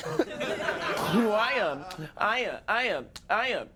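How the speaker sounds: high, drawn-out villain stalling; stall, then confess in a high put-on voice; hammed-up cartoon villain. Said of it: "villain's in there".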